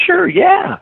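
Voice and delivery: squeaky high eager yes; a quick, very high "sure, yeah"; eager agreement. Retold or imitated. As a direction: jump at the offer, voice shooting up. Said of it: "And I go".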